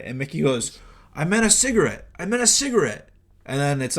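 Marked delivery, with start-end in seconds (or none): 1.1-3.1 high-pitched mock protest, same line insisted twice; high, swooping retold excuse. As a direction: imitate an indignant denial, pitched way up. Acts out Mickey's protest to being scolded.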